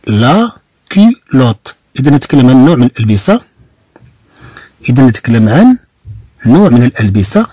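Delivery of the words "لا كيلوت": لا كيلوت said slowly, every syllable clear; slow model word. Shown as a demonstration. Teacher repeating a word slowly so the class can copy. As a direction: drag out كيلوت for learners.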